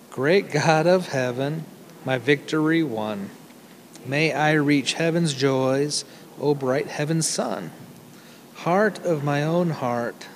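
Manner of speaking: slow, measured verse with pauses between lines; solemn hymn recitation. Acted. Preacher reciting an Irish hymn to the congregation, slow and reverent. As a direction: read it slowly, line by line, as devotional poetry.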